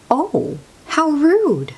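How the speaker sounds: high, slow, playful tut-tut; mock indignation. Reader teasing sleepy students, mock shocked. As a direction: pretend to be shocked at the rudeness.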